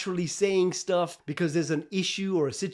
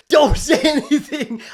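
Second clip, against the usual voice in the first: louder, higher, slow, with a laugh; mock-bellow the warning while laughing; laughing inner voice; 9 semitones higher, 10 dB louder than usual. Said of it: "this little voice in my head who's saying".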